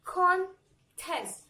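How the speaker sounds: "Contest" split as con, pause, test; syllable by syllable. Shown as a demonstration. Teacher leads the chin-drop exercise, syllable by syllable. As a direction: break "Contest" into two separate beats. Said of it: "do the exercise with me".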